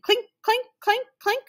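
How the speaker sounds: high, even little clinks; imitated high-pitched metallic punching; ticket punch clinks. A narrator's reading. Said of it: "the conductor punched tickets".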